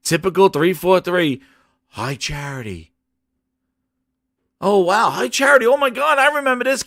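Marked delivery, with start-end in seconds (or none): 1.9-2.9 low, soft mock wonder; hushed recognition. Acting out recognition of the city. 4.6-6.8 high-pitched fan gushing; excited. Retold or imitated. Fan recognising High Charity on screen, excited gushing. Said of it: "Getting all excited".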